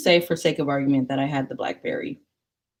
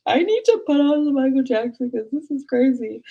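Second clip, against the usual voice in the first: high, whiny mock-distraught voice; overwrought plea; 9 semitones higher, 4 dB louder than usual. Upset student in sewing class, overwrought plea. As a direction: plead it high, half wailing.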